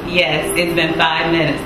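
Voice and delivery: ordinary delivery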